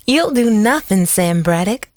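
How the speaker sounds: jovial, mocking dismissal. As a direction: brush him off cheerfully. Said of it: "she said jovially".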